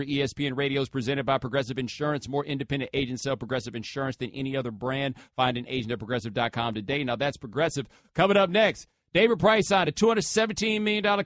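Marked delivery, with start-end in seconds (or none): 0.0-7.9 soft, even sponsor read; host reading a live insurance ad, understated ad voice. Acted. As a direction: read the ad copy smoothly. Reads sponsor ad.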